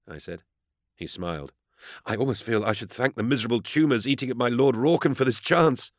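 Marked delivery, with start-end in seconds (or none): 2.0-5.9 higher voice, building in eagerness; gushing junior inquisitor. A narrator's reading. Vervoek thankful for this rare chance, gushing eagerness. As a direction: overflow with awkward gratitude.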